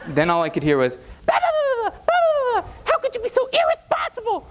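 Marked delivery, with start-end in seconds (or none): 1.3-2.7 very high yammering bah bah; mom's ranting babble. Retold or imitated. Frantic mother ranting at her son, very high babble. 2.8-4.4 very high shrieking mother voice; hysterical mom. Retold or imitated. Frantic mother on the phone to her drunk son, very high.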